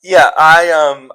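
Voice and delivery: normal talking voice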